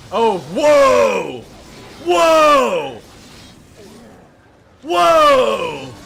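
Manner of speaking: loud, high whoa of amazement; amazed shout. Real, not acted. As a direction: yell it out in awe. Amazed at a move.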